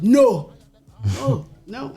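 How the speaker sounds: imitating a strict Dominican father; loud, firm refusal in a Dominican accent. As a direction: play Dad refusing in his Dominican accent. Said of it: "what is that accent?".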